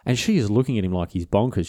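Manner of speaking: ordinary talk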